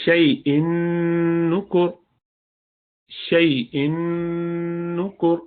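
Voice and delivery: شیء نکر with a nasal hum; nasal merge into نکر; recited with ghunna. Shown as a demonstration. Teacher reciting example: nasal ghunna. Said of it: "غنہ کی آواز آنی چاہیے".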